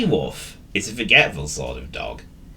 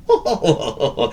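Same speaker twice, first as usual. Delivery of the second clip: high, mischievous chortle; cartoon dog chuckle; 8 semitones higher than usual. A narrator's reading. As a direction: chortle to yourself over a prank. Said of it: "He chortled".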